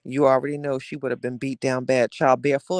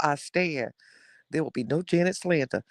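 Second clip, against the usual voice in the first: ordinary delivery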